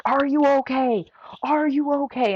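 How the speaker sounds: high-pitched imitation of urgent calling; mock yelling. Volunteer checking on a freezing runner, mock yelling. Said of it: "like, yelling at me".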